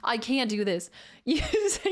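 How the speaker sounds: normal talking voice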